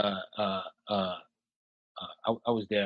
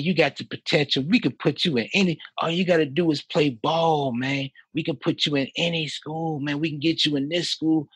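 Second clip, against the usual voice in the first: imitating the assistant coach; 6 semitones higher, 3 dB louder than usual. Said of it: "I'm trying to imitate his voice best I can".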